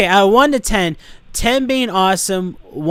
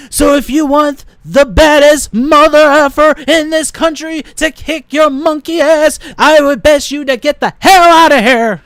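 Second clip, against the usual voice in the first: loud, much higher, slowed swaggering wrestler growl; The Rock impression; 9 semitones higher, 8 dB louder than usual. Threatening the manager like a wrestling champ, loud swagger. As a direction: puff up and lay down a champion's threat. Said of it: "That was kinda like The Rock".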